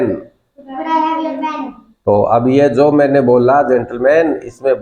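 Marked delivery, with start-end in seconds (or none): 0.0-1.6 high, soft, slow class chorus echo; a class echoing the teacher slowly; students repeating in chorus. Shown as a demonstration. Students echo the teacher's request in unison. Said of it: "तो बोलिए".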